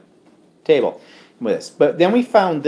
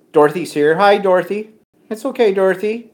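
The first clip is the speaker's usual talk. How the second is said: ordinary talk, nothing marked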